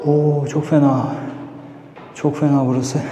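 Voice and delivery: gasping for air; breathless and strained; wheezy voice. Real, not acted. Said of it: "Hiç hava yok".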